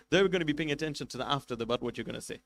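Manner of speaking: ordinary talk, nothing marked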